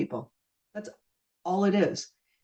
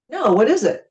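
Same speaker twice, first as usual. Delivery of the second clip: curious surprise; 6 semitones higher, 5 dB louder than usual. Retold or imitated. Stranger asks about the patch.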